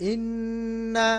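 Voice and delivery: ইন্না high, the nn held nasal; nasal ghunna. Shown as a demonstration. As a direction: hum the nn of ইন্না. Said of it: "এখানে নু গুন্না হবে".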